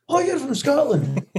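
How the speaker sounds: high, drawn-out Scottish exclamation; mock Scottish accent. Singer mimicking Scots he meets in America, mock Scottish accent. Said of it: "do a Scottish accent".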